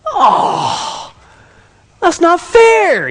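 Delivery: high, loud, drawn-out mock teen whine; whining protest. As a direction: wail it like a punished kid. Son protests the punishment.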